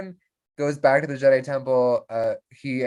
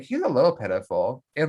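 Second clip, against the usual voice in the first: ordinary talk, nothing marked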